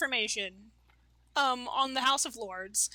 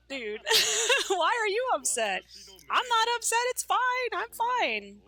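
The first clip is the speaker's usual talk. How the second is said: higher, louder with a laugh, insisting all is fine; brushing off a friend's anger with a laugh; 8 semitones higher, 3 dB louder than usual. Retold or imitated. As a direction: laugh away the worry.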